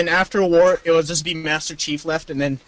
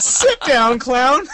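mocking taunt; 6 semitones higher, 5 dB louder, much slower than usual. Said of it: "I'm like".

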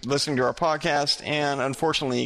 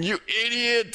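high-pitched mock scream; retold scream; 9 semitones higher than usual. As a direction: imitate someone screaming an insult on the run. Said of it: "I scream while running".

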